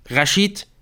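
high, rising call; calling after someone. A narrator's reading. Nick calls after Rashid on the stairs, calling out. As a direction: call out to stop him. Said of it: "rief Nick ihm nach".

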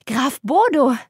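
light up at the name; joyfully excited. A narrator's reading. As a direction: countess repeats the name with delight. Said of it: "wiederholte die Gräfin freudig erregt".

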